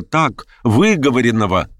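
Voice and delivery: plain reading voice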